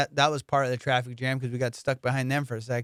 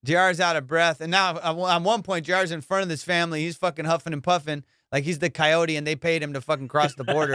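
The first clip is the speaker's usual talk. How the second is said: ordinary delivery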